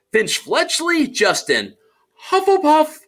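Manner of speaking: calling out, then shouting. Acted. Name called, then house.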